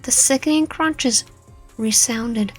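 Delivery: ordinary delivery, nothing marked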